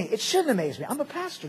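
ordinary delivery

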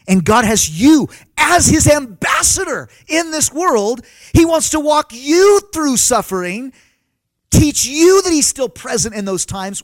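slow, high preaching build. Acted. Pastor to congregation, slow rising preaching voice. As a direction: lift it up like a pastor pressing home the point.

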